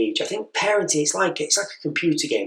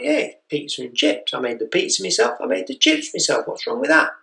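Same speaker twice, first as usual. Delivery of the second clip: defensive rising; 7 semitones higher than usual. Retold or imitated. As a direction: pitch climbs as he defends his cooking. Defending his homemade meal.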